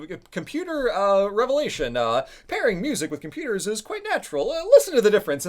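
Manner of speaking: high, loud retro commercial voice; vintage ad announcer. Retold or imitated. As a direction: sell it like an old ad man. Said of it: "tiny interspersed commentary of this guy".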